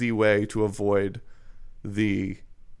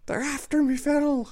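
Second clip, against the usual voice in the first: put-on very high cranky old coot; old prospector. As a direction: squeak it like a wild old miner. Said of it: "Just an old prospector shaking a stick".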